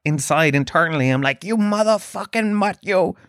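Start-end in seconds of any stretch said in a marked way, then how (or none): 1.4-3.2 higher, inner gangster fury, lightly voiced; a hint of a Goodfellas hothead snarling at a mutt; Joe Pesci rage. Inner rant at the popcorn thief, mild mock gangster fury. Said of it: "Joe Pesci in Goodfellas".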